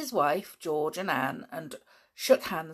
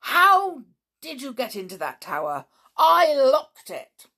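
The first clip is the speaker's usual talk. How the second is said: much louder and higher, slowing on the last words; furious; 7 semitones higher, 8 dB louder than usual. Acted. Uncle demanding how the children got into the locked tower, furious. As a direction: demand it like an outraged man. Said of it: "still looking furiously".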